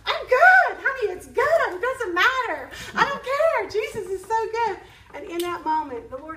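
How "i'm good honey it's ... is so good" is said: excited laughing reply. Retold or imitated. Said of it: "And I'm going".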